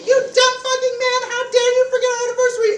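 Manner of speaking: put-on screaming girlfriend. [name] mimics his girlfriend screaming about their anniversary, high and loud. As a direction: screech it like a furious girlfriend. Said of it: "She's screaming at me".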